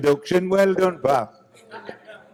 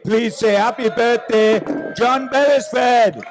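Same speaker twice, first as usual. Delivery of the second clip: rising, much higher and louder announcer build to the name; big announcer intro; 7 semitones higher, 3 dB louder than usual. Real, not acted. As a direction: build it up like a compère calling on the star. Announcer bringing on birthday guest.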